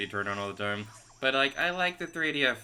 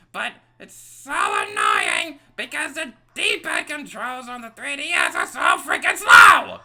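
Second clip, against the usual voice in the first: loud, high, exasperated ranting; high angry rant; 11 dB louder than usual. Real, not acted. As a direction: vent at full volume. Ranting about controls.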